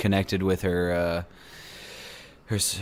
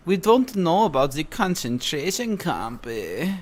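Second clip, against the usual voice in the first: mock German accent; 9 semitones higher than usual. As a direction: play the clueless German denier. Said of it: "You think my German accent is that bad?".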